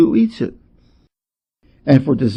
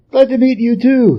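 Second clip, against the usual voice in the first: much higher, breezy monkey voice; cheeky Monkey; 10 semitones higher than usual. A narrator's reading. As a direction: play the brash upstart monkey.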